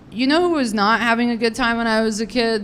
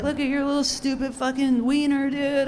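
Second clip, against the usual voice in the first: mocking jeer; 6 semitones higher than usual. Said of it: "laugh at them".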